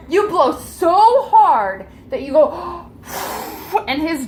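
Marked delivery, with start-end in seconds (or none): 0.1-2.9 excited telling. A narrator's reading. GM narrating a mighty blow.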